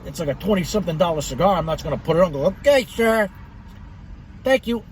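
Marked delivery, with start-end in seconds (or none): 2.5-4.8 mock meek. Imagined meek reply to guard.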